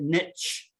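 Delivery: "niche" said as "nitch"; short vowel and a tch ending on "niche"; variant pronunciation. Shown as a demonstration. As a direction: rhyme "niche" with "pitch". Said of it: "Some people pronounce it niche".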